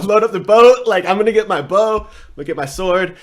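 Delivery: loud, laughing mock battle cry; mock gung-ho. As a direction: ham up the soldier itching for a fight. Imagined gung-ho soldier.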